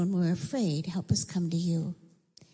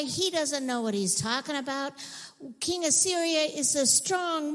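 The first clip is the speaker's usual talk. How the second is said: yelled threats; 7 semitones higher than usual. Acted. Assyrian officials yelling up to Jerusalem's walls, high taunting shout. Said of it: "they yelled up to the walls".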